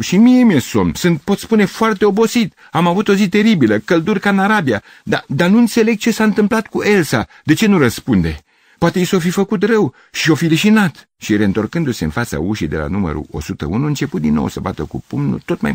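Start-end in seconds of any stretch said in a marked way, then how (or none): 0.0-11.1 high, loud, frantic explanation; worked-up, distraught outpouring; agitated pleading. Acted. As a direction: plead your case over angry neighbours. Gavrilescu argues back, distressed.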